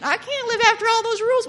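high, fast, loud protest; mock panic. Reader overwhelmed by all those rules, mock panic. Mimics people dreading Leviticus.

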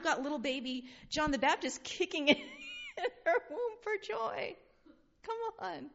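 high, tearful laughing through the words; choked-up, laughing delight; laughing with joy. Real, not acted. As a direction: overcome with joyful laughter. Baby leaping for joy story.

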